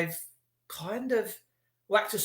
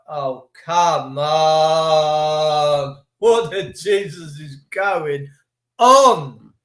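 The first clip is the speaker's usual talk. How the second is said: exasperated drawl; 2 semitones lower, 7 dB louder, much slower than usual. Retold or imitated. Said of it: "I'm like".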